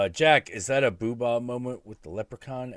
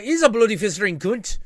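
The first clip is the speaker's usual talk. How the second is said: high, loud Irish quote; Rory's brogue; 12 semitones higher, 9 dB louder than usual. Retold or imitated. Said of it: "He's like".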